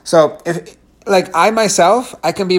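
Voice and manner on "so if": ordinary delivery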